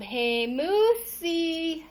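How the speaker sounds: loud, drawn-out call across the yard; yelled. A narrator's reading. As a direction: slowly call out to the moose. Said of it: "and yelled".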